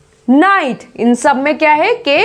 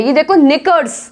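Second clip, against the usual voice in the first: ordinary talk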